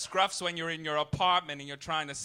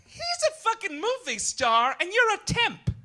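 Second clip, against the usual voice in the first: high, loud indignant snap; shrill-free mock outrage, loud and high; pissy; 13 semitones higher, 7 dB louder than usual. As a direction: get huffy and indignant, pitched way up. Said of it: "I got real pissy".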